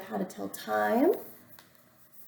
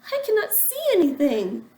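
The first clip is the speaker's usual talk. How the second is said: whiny dazzled Toad; 7 semitones higher, 8 dB louder than usual. Acted. Dazzled Toad complains loudly.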